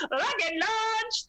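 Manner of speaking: put-on high, slow Venezuelan accent chant; Venezuelan accent impression. Mother quoting the Venezuelan rally woman's chant. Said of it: "this woman's voice".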